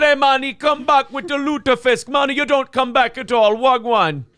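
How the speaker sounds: high, loud Jamaican patois with Minnesota vowels; Swedish Jamaican accent. Acted. Joking bartender voice, Swedish Jamaican accent, high and loud. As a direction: mash a Jamaican voice into a Scandinavian one. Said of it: "It's the Swedish Jamaican".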